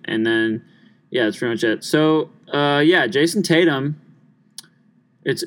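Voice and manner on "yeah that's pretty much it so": ordinary delivery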